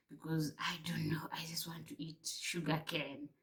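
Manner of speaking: hushed unvoiced whisper. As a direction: whisper it like a secret craving. Pleading with sister for cravings.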